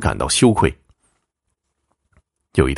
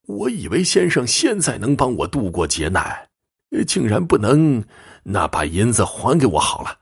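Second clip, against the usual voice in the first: put-on higher-pitched old man, let down; doubtful old client; 5 semitones higher than usual. Old man doubting the fortune-teller, higher-pitched and let down. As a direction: voice a disappointed old man asking for a refund.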